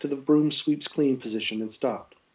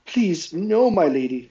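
higher, small frightened plea; scared; 5 semitones higher, 4 dB louder, slower than usual. A narrator's reading. Maid refusing her lady's offer, scared. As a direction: voice a timid servant pleading. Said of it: "in a tidy, scared voice".

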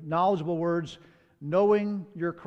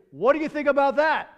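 bold challenge; 8 semitones higher, 6 dB louder than usual. Retold or imitated. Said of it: "this is just a bold personality".

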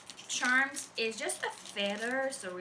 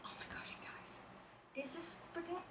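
whispered oh my gosh you guys; awed whisper; 4 semitones higher, 14 dB quieter, faster than usual. Real, not acted. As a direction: breathe it out in awe.

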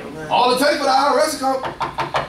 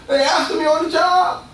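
half-hearted cry of persecution; complaining believer; 7 semitones higher than usual. Pretend. Preacher voices a churchgoer's job complaint, mock shout.

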